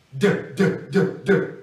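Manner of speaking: repeated voiced D sounds, vocal cords buzzing; voiced D demo. Shown as a demonstration. Teacher drilling the voiced D for students, clearly. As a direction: sound out the D with voicing. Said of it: "é voiced".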